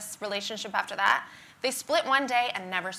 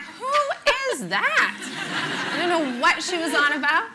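laughing bafflement; 9 semitones higher, 6 dB louder, slower than usual. Retold or imitated. Baffled by Sarah Pascoe's Henry joke.

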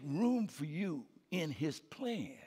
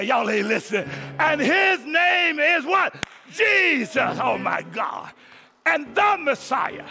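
loud, high, shouted sermon climax; preaching at full force; 8 semitones higher, 14 dB louder than usual. Acted. Pastor rousing his congregation at Christmas, full-force shouted preaching. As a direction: whip the church up with a ringing shout. Preaching about the angel's announcement.

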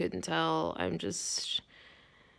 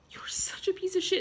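angry whisper; 9 semitones higher than usual. Acted. Spat insult in whisper.

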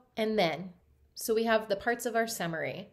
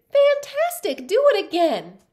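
high, loud cheering shout; excited shout; 10 semitones higher, 10 dB louder than usual. Acted. Elizabeth eggs on the tired dragon with a loud, high shout. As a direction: egg him on with a big yell. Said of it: "Elizabeth shouted".

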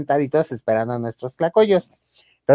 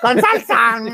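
imitating vendor; 8 semitones higher, slower than usual. Host imitating the tlacoyo vendor, high yell. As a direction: belt it like the stall lady. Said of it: "imitates vendor".

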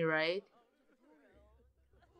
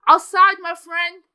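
mock command; 6 semitones higher, 13 dB louder than usual. Real, not acted. Mock host order.

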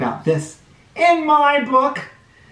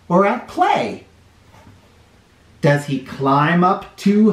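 put-on lively storybook read-aloud for little kids; sing-song picture book reading; reading to children; 2 semitones higher, slower than usual. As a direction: read it like story time on the rug.

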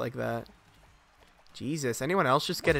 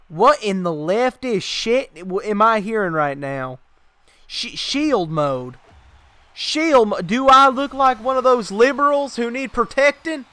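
hammed-up macho outrage, louder and higher; mock tough-guy rant; 8 semitones higher, 6 dB louder than usual. Streamer mocks his shield mode, hammed-up macho outrage. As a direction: play an offended tough guy scoffing.

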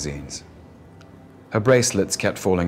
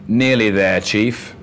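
drawl; 3 semitones higher, 5 dB louder, slower than usual. Acted. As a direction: drawl it out, camp and lazy. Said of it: "Corky drawls".

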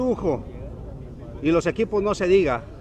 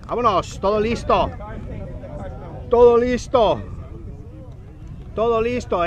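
building, louder kickoff calls; 5 semitones higher, 4 dB louder than usual. Real, not acted. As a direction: call out the kickoff. Calling kickoff, repeated.

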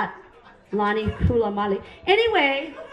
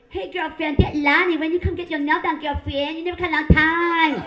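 higher-pitched imitation of a Vietnamese nail lady; hip Vietnamese accent; 5 semitones higher, 4 dB louder than usual. Nail lady phoning her regular client, hip Vietnamese accent. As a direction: put on a Vietnamese accent, trying to sound hip. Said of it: "tries to talk hip with that accent".

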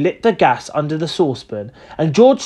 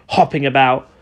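ordinary delivery

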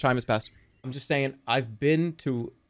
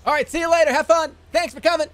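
mocking farewell; 12 semitones higher, 4 dB louder than usual. Real, not acted. Taunts a departing player.

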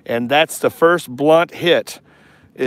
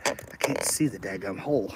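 low, much softer grumble to himself; frustrated mutter; 6 semitones lower, 12 dB quieter than usual. Real, not acted. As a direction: mutter it under your breath at the screw. Struggling to fit a screw.

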